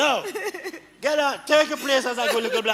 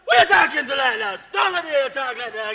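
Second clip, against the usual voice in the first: angry rebuke; faster than usual. Acted. Man scolds woman for answering back, angry rebuke. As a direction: round on them angrily.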